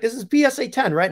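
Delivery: high-pitched Italian caller impression; strong Italian accent. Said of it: "Strong Italian accent".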